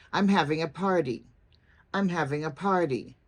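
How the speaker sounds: statement falling in pitch on "party"; falling intonation. Shown as a demonstration. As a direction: let the pitch drop at the end on "party". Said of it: "you finish by going down in pitch".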